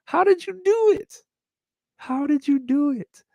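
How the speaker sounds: high squeaky admiration; mock awe; amazed falsetto. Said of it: "So I'm like".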